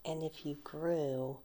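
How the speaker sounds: soft ASMR whisper over tapping; whisper with nail taps. Real, not acted. ASMR whisper showing coral shells.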